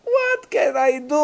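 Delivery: mock lament in English; mock woman in English. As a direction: stretch out the helpless plea. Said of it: "באנגלית".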